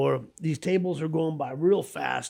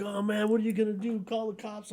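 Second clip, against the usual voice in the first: put-on scared kid; 9 semitones higher than usual. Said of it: "I'm like".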